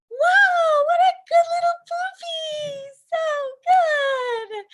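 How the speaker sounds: put-on very high, gushing voice for a baby; high-pitched baby talk. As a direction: coo loudly at a baby in an exaggerated squeal. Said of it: "it's in a high-pitched voice".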